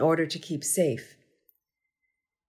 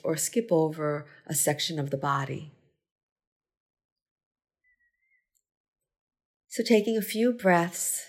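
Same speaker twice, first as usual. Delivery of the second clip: unhurried meditation guide, long pauses between phrases; measured, spacious gratitude-practice delivery; guided meditation. Acted. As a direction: lead a body scan, giving each phrase space to settle. Leads a gratitude body scan.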